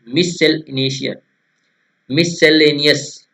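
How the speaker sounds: मिससेलेनियस slow, split as mis-sel-enious; broken into parts. Shown as a demonstration. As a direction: break मिससेलेनियस into pieces for memorising. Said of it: "ऐसे तोड़कर याद कीजिए इसको". (मिससेलेनियस को धीरे-धीरे तोड़कर, मिस-सेल-एनियस)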